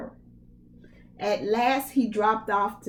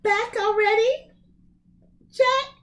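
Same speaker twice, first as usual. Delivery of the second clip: higher, louder motherly greeting; high mother's voice; 13 semitones higher, 5 dB louder than usual. Acted. Mother greeting Jack home, high and bright-voiced. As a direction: call out as Jack's eager mother.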